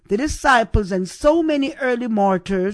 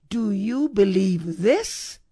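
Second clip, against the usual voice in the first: slow question, "this" swooping upward at the end; stress on THIS. Shown as a demonstration. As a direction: punch the last word "this". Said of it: "I've added the emphasis".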